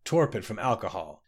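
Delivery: plain reading voice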